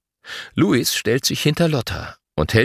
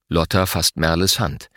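ordinary delivery

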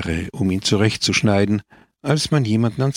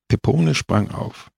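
usual speaking voice

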